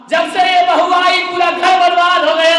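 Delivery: wailing complaint. Retold or imitated. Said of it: "रोते रोते आई".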